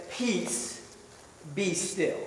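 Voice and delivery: calm command. Retold or imitated. Jesus calming the storm, calm and soft. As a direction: still the storm with quiet authority. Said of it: "Jesus calmly stares into the storm".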